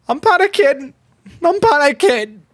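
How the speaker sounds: high-pitched panicky yelp; panicking. Real, not acted. Losing manager tells chat of nerves, high panic. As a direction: blurt it high, losing your cool.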